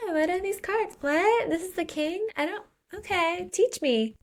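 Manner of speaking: high, airy feigned confusion, playing dumb; fake clueless. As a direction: pitch it up like a girl pretending she can't follow the cards. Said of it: "Arvel pretends she doesn't know how to play".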